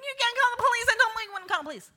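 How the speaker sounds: high, fast, urgent pleading; frantic. Retold or imitated. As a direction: beg him not to call, in a rush. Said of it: "Notice, now I'm frantic".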